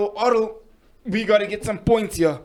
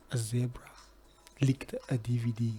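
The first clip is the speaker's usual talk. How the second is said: breathy whisper, close to the mic; 7 semitones lower, 11 dB quieter than usual. Shown as a demonstration. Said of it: "It's called the Whisper Challenge".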